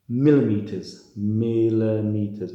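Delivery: teacher stressing the key word millimeters; emphasized word. Shown as a demonstration. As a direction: hit millimeters hard, drawing out the second. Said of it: "emphasizing certain words".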